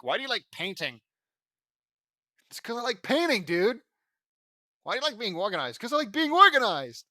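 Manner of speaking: loud, high mock exchange; exaggerated yelling, parodying stilted dialogue; mocking exasperation. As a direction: hammer out the silly answers in ridicule. Mocks the dialogue as dumb.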